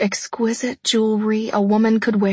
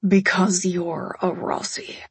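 whispered; 2 semitones lower, 4 dB quieter than usual. A narrator's reading. Cold fiancé answering her up close, whispering. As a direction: whisper it close against the lips. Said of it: "He lowered his lips to mine and whispered".

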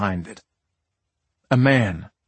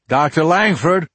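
louder, carrying call; 7 semitones higher, 5 dB louder than usual. Acted. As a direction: call his name across the road. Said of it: "I called out".

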